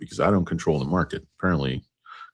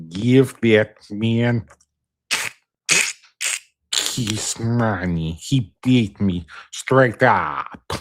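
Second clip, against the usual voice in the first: slow, drawn-out Russian gangster, pitched up; Russian gangster accent; 7 semitones higher, 4 dB louder, much slower than usual. Acted. Gangster concedes a card game, slow mock Russian accent. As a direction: stretch each word in a mock Russian accent. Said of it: "you don't sound like no Russian gangster".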